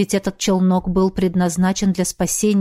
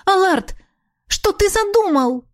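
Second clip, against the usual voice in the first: high, louder anxious call; 11 semitones higher than usual. Acted. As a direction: call out his name louder. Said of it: "а потом уже громче позвала она".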